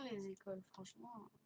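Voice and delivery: usual speaking voice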